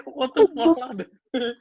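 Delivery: high-pitched disbelieving yelp, laughing; shocked outburst. Retold or imitated. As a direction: squeal it in total disbelief. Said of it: "I was like".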